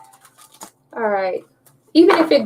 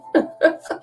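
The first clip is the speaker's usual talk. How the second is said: breathy giggle; 7 semitones higher than usual. Real, not acted. Laughing at own slip.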